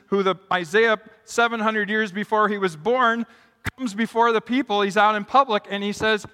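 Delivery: usual speaking voice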